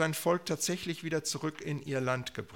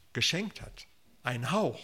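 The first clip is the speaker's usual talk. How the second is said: ordinary talk, nothing marked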